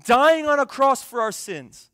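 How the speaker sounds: loud, high, drawn-out preaching; impassioned preaching. Real, not acted. Preacher to congregation on the cross, loud and impassioned. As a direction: preach it at full force, hammering the key words.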